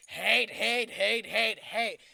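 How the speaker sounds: loud mocking repetition of hate; mocking yell. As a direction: throw his word back at him, loud. Mocking Am's repeated word.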